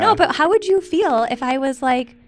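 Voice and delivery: high, loud indignant outburst; angry. Real, not acted. Wife furious at husband over cruel joke, high and loud. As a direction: fume at him, voice rising.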